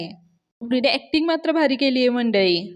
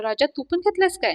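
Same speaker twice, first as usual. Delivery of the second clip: teasing; 4 semitones higher than usual. Retold or imitated. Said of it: "राजाची चेष्टा करायला लागतो".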